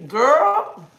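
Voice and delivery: a big knowing exclamation. Real, not acted. Reacting to a viewer's rose, loud high girl.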